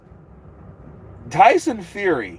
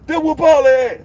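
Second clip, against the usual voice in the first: put-on much higher, louder shouting old trainer voice; Floyd Senior impression; 7 dB louder than usual. Doing Floyd Senior for the stream, a high loud boastful yell. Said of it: "Oh, Floyd Senior".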